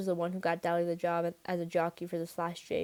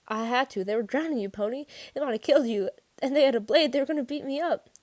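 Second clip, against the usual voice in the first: higher, louder, building desperation; distraught; 7 semitones higher, 6 dB louder than usual. A narrator's reading. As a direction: plead your case, rising as you go. Shaken boy justifies the killing.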